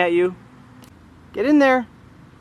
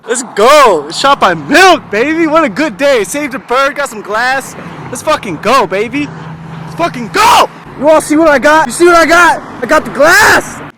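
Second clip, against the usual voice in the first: hyped celebration; 3 semitones higher, 10 dB louder than usual. Real, not acted. Showing off the new glass piece to the camera, loud hype. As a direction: shout your win to everyone.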